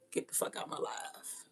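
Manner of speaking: unvoiced dismissive whisper; whispered send-off to a banned viewer. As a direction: breathe it out coldly. Banning a commenter.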